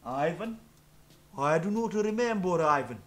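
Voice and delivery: hammed-up stupid Russian accent; thick mock Russian accent, played dumb; fake Russian accent. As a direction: do a silly, over-the-top Russian meme voice. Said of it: "speaking with a stupid Russian accent".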